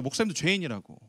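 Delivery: ordinary delivery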